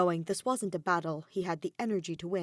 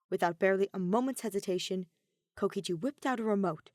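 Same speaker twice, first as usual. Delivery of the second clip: usual speaking voice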